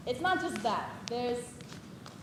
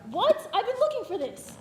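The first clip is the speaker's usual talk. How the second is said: surprised; 8 semitones higher, 4 dB louder than usual. Real, not acted. Startled exclamation.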